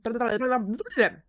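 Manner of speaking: loud, high garbled babble noise; frustrated splutter. Real, not acted. Reader fumbles mushroom line, loud splutter. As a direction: blow a raspberry-like splutter at the slip.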